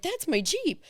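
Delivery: high, bright-eyed spotting her car; excited recognition. Retold or imitated. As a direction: blurt it out, thrilled to see the Jeep. Retold spotting her Jeep.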